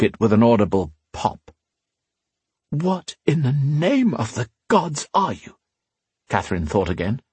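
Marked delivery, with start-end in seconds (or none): 2.7-5.6 stunned disbelief. A narrator's reading. Catherine's stunned thought at the fighter.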